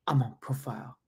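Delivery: soft, drooping excuse to a sergeant; quiet mock plea of being excused; reel impression. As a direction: mimic a feeble soldier's excuse. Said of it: "that reel? It's like".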